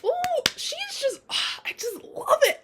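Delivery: very high, breathless fangirl squeal; giddy squeal. Real, not acted. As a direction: squeal like your favorite ship just happened. Fangirling over Annabeth.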